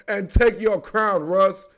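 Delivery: ordinary delivery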